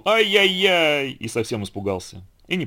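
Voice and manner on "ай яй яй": high, long-drawn yelp; pained cry. A narrator's reading. Donkey whose tail the bear cub yanked, pained cry. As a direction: wail "ai" slowly and high. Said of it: "ослик вдруг крикнул".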